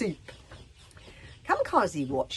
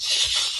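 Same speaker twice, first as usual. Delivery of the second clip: loud shush; 8 dB louder than usual. Acted. Chief hushes.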